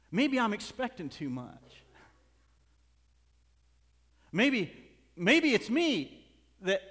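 loud, high-pitched sermon delivery; full-force preaching. Real, not acted. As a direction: drive it home to the congregation.

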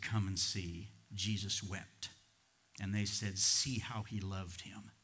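low, soft, solemn Bible reading; measured, hushed scripture narration. Acted. Reads scripture aloud to congregation.

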